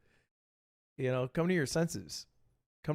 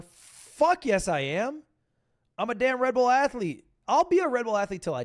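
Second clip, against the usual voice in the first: higher and louder, indignant burst; loud proud claim; emphatic yes; 7 semitones higher, 4 dB louder than usual. Real, not acted. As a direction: fire back proudly. Indignant answer about Red Bull.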